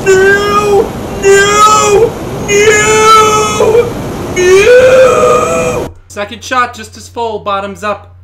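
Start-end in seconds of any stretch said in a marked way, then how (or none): 0.1-5.9 screaming no. Real, not acted. Repeated deaths in game.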